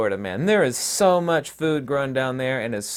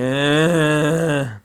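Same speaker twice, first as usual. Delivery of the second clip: long, loud droning "uhhh"; mock zombie groan; 3 semitones higher, 5 dB louder than usual. Mocking conformists waiting in the store line, long zombie groan. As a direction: groan it out like a mindless queuer.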